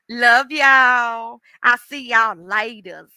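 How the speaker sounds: loud, slow Southern farewell; drawn-out country goodbye. Real, not acted. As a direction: call out a stretched Southern goodbye in your own accent. Own goodbye after failed British accent.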